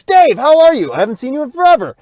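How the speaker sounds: a hint of excited celebrity greeting; warm greeting. Tom Cruise greeting Dave at the door, lightly excited. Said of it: "Tom opens up and goes".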